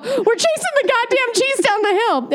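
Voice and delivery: high, laughing mock-indignant villagers; mock outrage. Voicing outraged locals who defend the cheese chase, mock outrage. Angry villagers retold, voiced.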